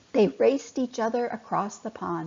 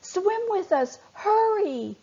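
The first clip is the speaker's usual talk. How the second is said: urgent yelling; 11 semitones higher, 4 dB louder than usual. Acted. Fish fleeing the turtle.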